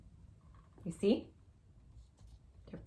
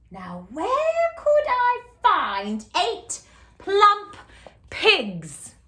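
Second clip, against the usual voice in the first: scheming witch; 11 semitones higher, 6 dB louder, much slower than usual. Acted. Witch wonders aloud.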